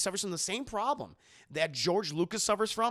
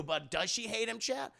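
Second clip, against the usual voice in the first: ordinary delivery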